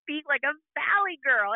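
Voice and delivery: high, playful mock-argument voice; teasing insistence. Mom insisting to her daughter, teasing high voice. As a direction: push back with high-pitched teasing.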